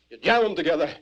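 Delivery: ordinary delivery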